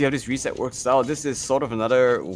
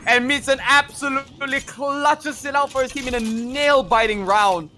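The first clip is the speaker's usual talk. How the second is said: high, loud hyped play-by-play; esports caster; 12 semitones higher, 3 dB louder than usual. Real, not acted. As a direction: call the clutch round live. Fast live play-by-play climax.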